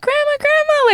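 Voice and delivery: high-pitched mock little-boy call; playful high kid voice; imitated excited kid. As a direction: imitate a child eagerly calling out. Voicing excited nephew running to grandma.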